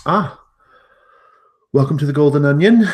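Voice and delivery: bright, high, loud hostess welcome, brisk; innkeeper hostess. Acted. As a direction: greet the guests like an over-eager innkeeper. Hostess greets arriving party.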